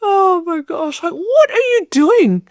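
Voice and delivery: very high, drawn-out exclamation; acted-out disbelief. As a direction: retell it as an aghast, high-pitched cry. Acting out her shock at husband.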